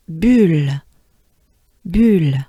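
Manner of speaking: "bulle" with rounded lips, short French u. Shown as a demonstration. Teacher demoing French word bulle for learners. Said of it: "how to say bubble in French".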